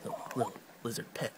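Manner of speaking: ordinary delivery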